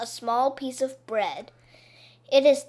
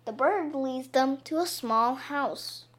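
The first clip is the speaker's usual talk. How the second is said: ordinary delivery, nothing marked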